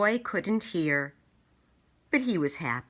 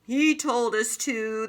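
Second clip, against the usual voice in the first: knights crying; 6 semitones higher than usual. Acted. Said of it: "they cried".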